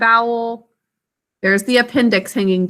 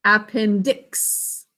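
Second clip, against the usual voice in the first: slow sounding out; 3 semitones lower, 4 dB quieter, much slower than usual. Shown as a demonstration. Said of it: "d, i, x".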